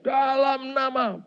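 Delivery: retold shout. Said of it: "Saya langsung teriak".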